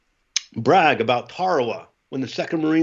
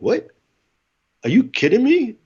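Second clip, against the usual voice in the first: shocked disbelief; 10 semitones higher, 4 dB louder than usual. Retold or imitated. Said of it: "I'm like".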